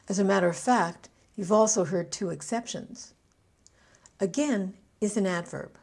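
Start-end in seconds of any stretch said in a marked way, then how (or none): 4.2-4.7 "Again" with the stress on "gain"; stress on second syllable. Shown as a demonstration. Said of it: "I stressed both on the second syllable".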